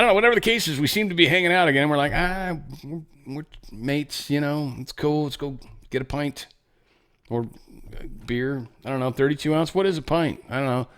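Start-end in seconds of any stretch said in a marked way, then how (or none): 2.1-8.7 higher, softer, lightly put-on British accent; mock British mate. Britain, voiced by the host, inviting the US for a pint, in a light mock British accent. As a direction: play a friendly British bloke.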